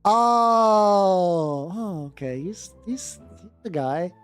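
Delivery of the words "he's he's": usual speaking voice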